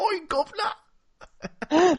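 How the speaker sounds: high, loud, drawn-out British holler; terrible British accent. Acted. Actor told to start his British accent, hammed-up. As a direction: ham up a fake British accent, slow and high. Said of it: "Start doing a British accent".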